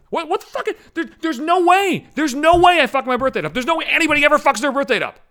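rising outrage, no way; indignant shouting at the bank; outraged. Real, not acted.

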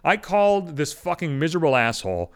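usual speaking voice

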